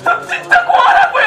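high, loud cry of despair; desperate cry. Acted. Student crying out to the man on the bridge. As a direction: cry out that you are an orphan.

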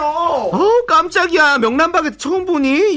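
startled. Acted. Startled by shout.

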